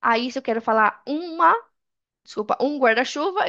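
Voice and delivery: ordinary talk, nothing marked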